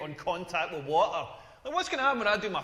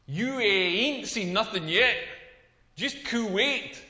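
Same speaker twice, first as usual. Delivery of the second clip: slow pun delivery; slower than usual. Acted. Pun punchline drawn out.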